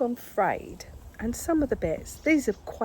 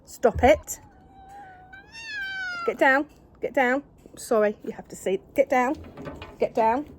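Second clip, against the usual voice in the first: higher, louder repeated commands; loud commands to the cat; 7 semitones higher, 6 dB louder than usual. Real, not acted. Owner ordering the cat off her, higher and loud.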